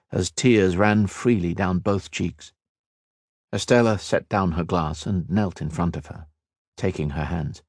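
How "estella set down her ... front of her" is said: plain reading voice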